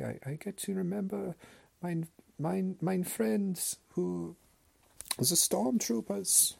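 put-on high German accent, very slow and drawn out; mock German grandpa. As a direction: stretch out each word, mock German grandpa. Grandpa impression on Remembrance Day.

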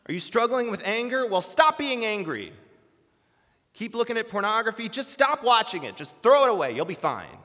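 loud, high, dismissive orders; mock yelled advice. Believer bluntly discipling another with struggles, mock yelling. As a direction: shout down each struggle as if easily fixed. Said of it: "screaming at themselves".

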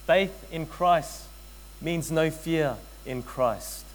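each word spaced out; slow sermon emphasis; slow, deliberate. Shown as a demonstration. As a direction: lay it down slowly like a verdict. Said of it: "Let me say that again".

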